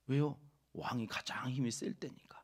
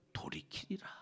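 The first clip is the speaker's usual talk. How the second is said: soft whispered close; whispered ending; 5 semitones higher, 8 dB quieter than usual. Acted. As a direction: breathe the word 돌이키리라 out. Reading verse, whispered ending. (돌이키리라를 속삭이듯)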